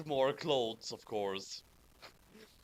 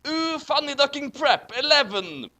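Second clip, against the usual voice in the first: louder, higher ooh, then bellow eleven; hammed-up excited cry; 10 semitones higher, 6 dB louder than usual. Reading the fanfic insult aloud, hammed-up yell. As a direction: belt it out like a fed-up heroine. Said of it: "I'm going to say funny ducking as well here".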